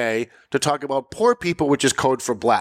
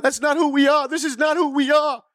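much higher, sing-out mock protest; 12 semitones higher than usual. Host repeating the politicians' stock denial, high mock protest. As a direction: parrot the slogan high and insistent.

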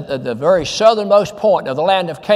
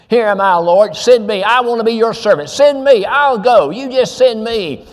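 louder, pitched up, pleading "Send me"; eager volunteer; 3 semitones higher, 3 dB louder than usual. Retold or imitated. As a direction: make "Here am I, Lord, send me" eager and loud, climbing high on "Send me". Fervent replay of Isaiah's answer.

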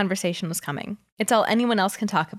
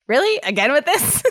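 amused disbelief; 7 semitones higher, 4 dB louder than usual. Acted. Suga amused by drunk proposal.

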